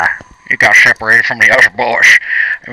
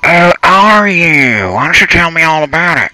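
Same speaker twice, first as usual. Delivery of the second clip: sarcastic; 8 semitones higher than usual. Acted. Captor asks the thirsty captive all about it, sarcastic. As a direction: stretch it out high with sarcastic sympathy. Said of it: "It sounded sarcastic".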